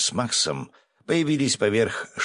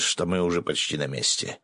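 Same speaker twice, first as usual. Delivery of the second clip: ordinary delivery, nothing marked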